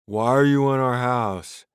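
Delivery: loud bewildered question; confused drunk. A narrator's reading.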